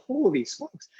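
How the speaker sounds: recalling being caught off guard; shocked exclamation. Retold or imitated. Husband hearing he's called a pastor, high shock. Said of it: "And I go".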